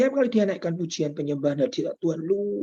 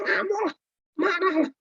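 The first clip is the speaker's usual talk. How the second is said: sudden high exclamation pitched far up; worked-up outburst. Retold or imitated. Preacher telling the church how furious people were, high worked-up outburst. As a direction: throw the line up high as if outraged.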